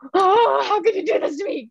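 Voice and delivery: high, fast, hammed-up betrayal; soap opera acting. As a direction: wail it like a wounded heroine, laughing. Mock betrayal over a quiz question.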